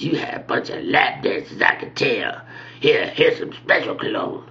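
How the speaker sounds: put-on old man's voice; old guy. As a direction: imitate an old attendant sizing up a customer. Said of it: "The old guy".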